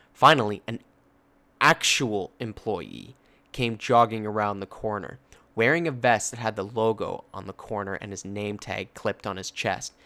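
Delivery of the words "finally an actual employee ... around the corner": ordinary delivery, nothing marked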